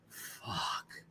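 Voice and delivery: whispered under the breath; thinking it, barely a whisper; silent inner curse. Retold or imitated. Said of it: "I don't say anything".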